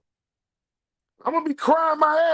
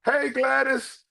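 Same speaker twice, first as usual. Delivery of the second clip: train driver; 7 semitones higher, slower than usual. Retold or imitated. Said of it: "The driver gonna look back and say".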